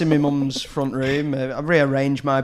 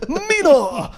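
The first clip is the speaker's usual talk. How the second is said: higher, louder mock posh English accent; Keanu Reeves as Dracula; 11 semitones higher, 6 dB louder than usual. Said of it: "talks with a very posh accent".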